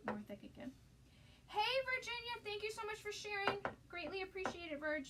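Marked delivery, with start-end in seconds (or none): 1.5-5.1 bright greeting. Real, not acted. Greeting a live viewer.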